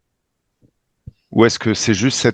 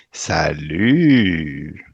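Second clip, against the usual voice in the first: long drawn-out, swooping hello; low start, swoop up and back down; mock greeting; 5 semitones lower than usual. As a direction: stretch the greeting out teasingly. Mock greeting to the summoned chief.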